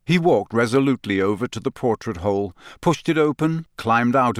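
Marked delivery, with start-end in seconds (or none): none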